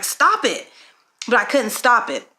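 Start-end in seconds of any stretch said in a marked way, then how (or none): 0.0-0.7 high, short self-command; retold inner scolding, pitched up; frustrated with herself. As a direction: order yourself to quit, exasperated. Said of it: "getting really frustrated with myself".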